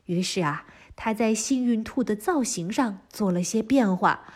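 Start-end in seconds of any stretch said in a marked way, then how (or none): none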